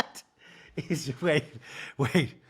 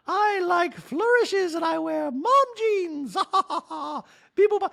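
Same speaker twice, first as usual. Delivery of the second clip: fussy droid tittering; Dorit robot voice; 14 semitones higher, 4 dB louder than usual. Retold or imitated. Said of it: "I do that voice for Dorit".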